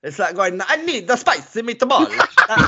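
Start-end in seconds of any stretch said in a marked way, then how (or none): 0.7-2.1 high, hammed-up Italian accent; mock Italian accent. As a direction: ham up a cartoonish Italian accent. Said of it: "doing accent on screen that I find offensive".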